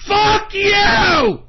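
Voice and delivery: high, loud furious yell; furious shout. Real, not acted. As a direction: spit it at them in real rage. Furious rant at e-celebs.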